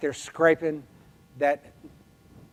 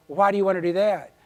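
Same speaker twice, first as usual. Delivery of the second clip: wary challenge; 5 semitones higher, 7 dB louder, faster than usual. Retold or imitated. Wary neighbor questions the offer.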